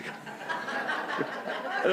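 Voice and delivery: breathless high squeal of a laugh. Real, not acted. Pastor caught out by his own blunder, helpless high laugh.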